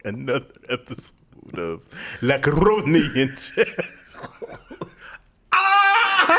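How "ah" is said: cry out. Real, not acted. End of episode outburst.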